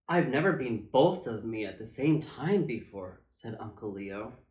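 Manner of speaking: plain reading voice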